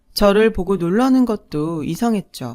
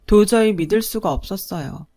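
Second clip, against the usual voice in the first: ordinary delivery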